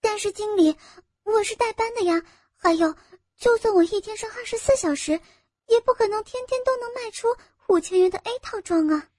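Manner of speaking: high-pitched, pleading objection; protesting. Acted. Employee disputing the sales quota with manager, protesting.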